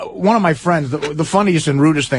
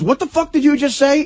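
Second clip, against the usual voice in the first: furious father; 11 semitones higher than usual. Retold or imitated. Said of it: "he turns around and goes".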